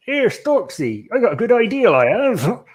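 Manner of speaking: high, sing-song Bristolian character; hammed-up Bristol yokel with rolling Rs; Bristolian accent. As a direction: play a chirpy Mister Storks in broad Bristolian. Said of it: "That Bristolian accent is".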